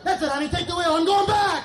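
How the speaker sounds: dad explodes. Retold or imitated. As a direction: snap and blow up at the backseat. Dad finally explodes at kids.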